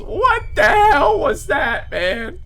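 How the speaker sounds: high shouted cry of shock; real scared yell. Real, not acted. Gamer shouting at the monster that killed him, shocked yell. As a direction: yell it out, rattled and disbelieving. Reacting to being murdered by mob.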